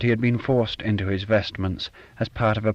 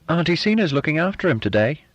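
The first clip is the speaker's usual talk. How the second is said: hint of triumph; 9 semitones higher than usual. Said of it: "with a hint of triumph".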